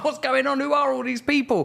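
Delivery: high, breathless mock panic at the crowd; overwhelmed frantic voice. To Shauna, acting out his overstimulated self, high frantic bewilderment. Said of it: "I talked about I'm overstimulated".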